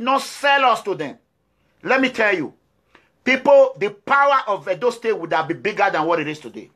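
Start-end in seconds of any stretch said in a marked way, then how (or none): 3.2-3.7 louder, higher call of "people"; rallying call to the audience; loud call to listeners. Real, not acted. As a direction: shout it out to the crowd to grab them. Calling out to the audience.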